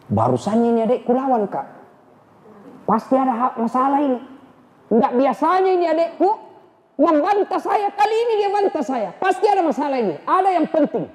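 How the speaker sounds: high, quick, musing aloud; puzzled Umar. Acted. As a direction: wonder why she stands up to you. Umar puzzled by sister's defiance.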